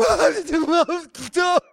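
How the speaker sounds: high, loud comic blubbering; hammed-up wordless sobbing wail; mock weeping. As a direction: imitate bawling over a sappy commercial. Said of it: "I'm back there weeping".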